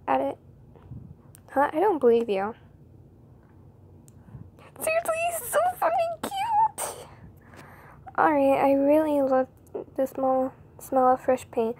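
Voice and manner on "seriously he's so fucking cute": very high, giddy squeal over a crush; squealing gush. Acted. Friend gushing about her crush, high giddy squeal.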